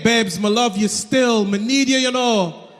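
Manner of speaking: wooing in a mock Jamaican accent. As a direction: sweet-talk her in a Jamaican accent. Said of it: "they come up to her with the same game".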